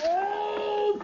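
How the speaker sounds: sudden high yelp; startled cry. Acted. Gildersleeve cries out when the ball breaks his window. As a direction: jolt out a shocked "Oh!".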